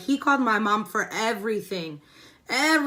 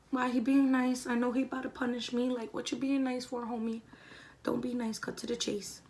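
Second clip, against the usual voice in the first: softer muttered inner voice; inner thoughts; 8 dB quieter than usual. Retold or imitated. Student's suspicious inner thoughts about the principal, hushed. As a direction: talk it under your breath like private thoughts.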